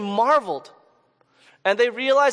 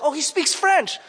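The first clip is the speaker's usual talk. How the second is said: pitched up high with glad surprise; happy to hear French from him; retelling their happy surprise; delighted surprise; 7 semitones higher than usual. Retold or imitated. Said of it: "They're like".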